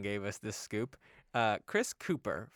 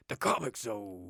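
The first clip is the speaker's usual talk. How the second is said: comic zone in a dramatic announcer voice; 2 semitones lower than usual. Shown as a demonstration. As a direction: boom comic zone like a show intro. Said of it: "Yeah, say in the voice".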